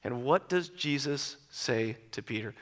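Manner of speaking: ordinary talk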